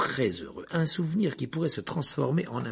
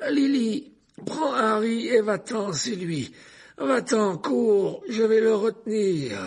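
crié haut et fort, dans l'urgence; père qui crie à sa femme de fuir; cri paniqué; 10 semitones higher, 6 dB louder, slower than usual. Acted. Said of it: "un homme qui criait, pris de panique". (père paniqué, cri aigu)

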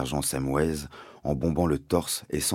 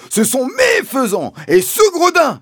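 high, shouted accusation; Mister Hazel crying out; 10 dB louder than usual. A narrator's reading. Landowner accusing the father before the policeman, high angry shout. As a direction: screech it, leaning hard on each word. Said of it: "s'est écrié mister Hazel".

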